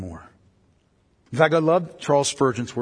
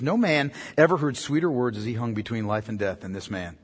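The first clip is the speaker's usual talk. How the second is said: ordinary delivery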